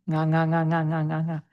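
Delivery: "nga" said again and again with the ng of sing; nga repeated. Shown as a demonstration. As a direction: drill the syllable steadily. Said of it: "Now take just the nga sound".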